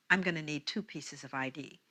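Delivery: low, quiet, businesslike request; curt, chatless cashier. Retold or imitated. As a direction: ask quietly, with no friendliness. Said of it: "No conversation".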